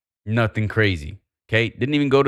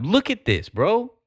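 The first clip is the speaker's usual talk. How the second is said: high, excited call-out; 11 semitones higher than usual. Real, not acted. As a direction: point at it, thrilled. Excited pointing at a chart.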